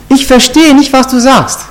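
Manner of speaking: high-pitched show of not getting it; playing clueless. Peter brushing off the maid as Americans do, playing clueless. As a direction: lift the voice as if totally baffled. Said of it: "so nie, gar nichts checken".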